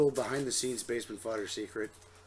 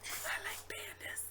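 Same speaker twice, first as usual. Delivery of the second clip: whispered; 9 dB quieter, slower than usual. Pretend. Panda calendar shown.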